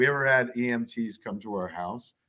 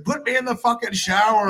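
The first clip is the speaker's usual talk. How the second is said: mock demand; 10 semitones higher, 8 dB louder than usual. Wife demanding shower.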